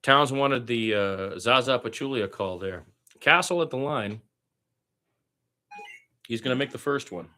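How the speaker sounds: lively radio play-by-play call of a Spurs game. Acted. Fan practicing radio play-by-play.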